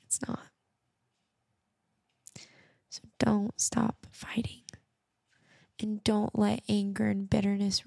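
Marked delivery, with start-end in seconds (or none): 0.0-4.6 soft whisper, close to the mic; whispered encouragement. Real, not acted. Podcaster urging hurting listeners to keep going, whispered. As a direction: whisper it like a promise to a friend.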